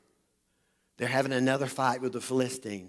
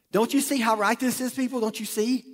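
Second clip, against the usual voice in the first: urgent persuading; 8 semitones higher, 3 dB louder than usual. Retold or imitated. Men urging David to kill Saul.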